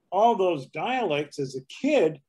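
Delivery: usual speaking voice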